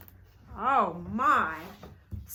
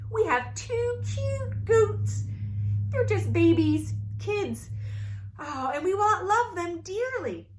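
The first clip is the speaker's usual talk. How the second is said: excited parents; 6 semitones higher than usual. Acted. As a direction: gush about the baby goats. Parents voiced in story.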